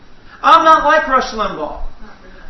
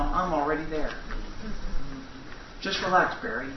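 much softer, lower self-talk; quiet musing; 7 semitones lower, 14 dB quieter than usual. Real, not acted. Preacher telling himself to relax, soft and low. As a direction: murmur it to yourself calmly. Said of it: "musing to himself".

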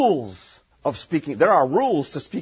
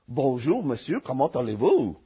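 Parisian French; 4 semitones lower, 3 dB quieter than usual. Shown as a demonstration. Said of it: "Parisian French".

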